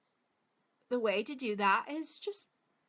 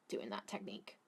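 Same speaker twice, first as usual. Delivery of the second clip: soft whispered aside; 12 semitones lower, 12 dB quieter than usual. Real, not acted. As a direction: drop to a whisper mid-sentence. Whispered aside while doing makeup.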